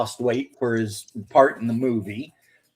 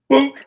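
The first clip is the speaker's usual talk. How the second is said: mock grenade launcher thump; 11 semitones higher, 8 dB louder than usual. Imitated grenade launcher shot.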